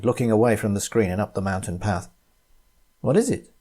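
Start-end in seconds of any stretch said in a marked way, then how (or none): 3.0-3.5 high, quick worried question; concerned question. Acted. Tom reacting to Tina's outburst, asking in concern. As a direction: ask it with sudden concern. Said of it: "asked Tom".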